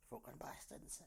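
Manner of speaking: under-the-breath swearing; whispered curse. Real, not acted. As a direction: mutter it off mic.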